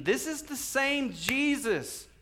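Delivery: high, slow accusing proclamation; preacher voicing a confronting apostle; Peter getting after them. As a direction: declare it slowly to the accused crowd. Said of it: "Peter gets after it".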